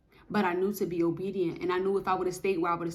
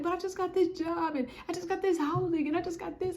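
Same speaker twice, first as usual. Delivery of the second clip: high whiny protest; mock whining; 9 semitones higher than usual. Protesting to God about leaving, high whine. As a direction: whine it like a child. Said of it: "I'm like".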